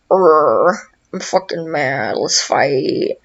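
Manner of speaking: low, grumbling beast voice; grumpy Bowser. Retold or imitated. Bowser grumbles at finding the team, low mock-angry monster voice.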